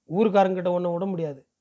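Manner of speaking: plain reading voice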